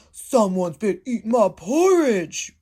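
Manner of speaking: big bear roar; roaring Daddy Bear. Acted. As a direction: boom it out as the big papa bear. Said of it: "roared Daddy Bear".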